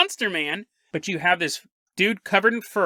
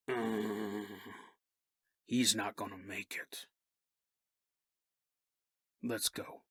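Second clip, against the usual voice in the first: much lower, very quiet and grim; somber; 11 semitones lower, 17 dB quieter, slower than usual. Acted. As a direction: mutter it low with grief. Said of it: "just kinda shakes his head".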